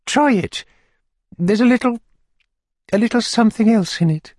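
high falsetto, haltingly confiding; coaxing lady. A narrator's reading. Lady urges the beadle to taste, high coaxing voice. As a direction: coax him in a high ladylike voice.

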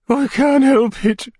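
very high, slow lady's whimper. A narrator's reading. Lady answers the beadle, high helpless whimper. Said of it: "whimpered the lady".

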